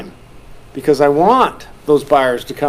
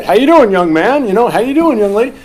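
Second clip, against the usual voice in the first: cheerful greeting; 5 semitones higher, 5 dB louder than usual. Shown as a demonstration. Greeting kids warmly.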